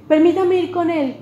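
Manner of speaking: petición más aguda y fuerte; súplica actuada. Retold or imitated. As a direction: pide permiso con apremio, voz más alta. Ahimás pide permiso, actuado. (petición insistente, en español: ruego apremiante)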